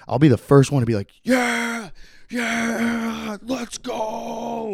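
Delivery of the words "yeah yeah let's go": mock hype cheer. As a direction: egg them on in a held-back yell. Acting the hyped egger-on.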